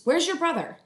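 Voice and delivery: a busy mom calling up; mom's voice. Acted.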